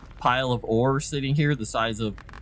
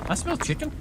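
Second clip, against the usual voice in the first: high little cat voice; voicing the cat; 13 semitones higher, 3 dB quieter, faster than usual. Owner voicing his sniffing cat, high and small. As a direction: give the cat a tiny high voice. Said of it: "Calvino's sniffing the air like".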